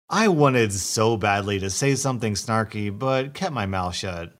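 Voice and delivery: usual speaking voice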